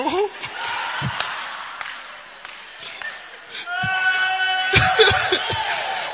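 long high shouted laugh. Real, not acted. Preacher laughing at his own schoolboy story, high laugh. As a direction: crack up at the memory.

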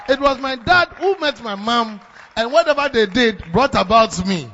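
preacher driving home the point, shouting; preaching shout. Real, not acted. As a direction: shout it to the congregation.

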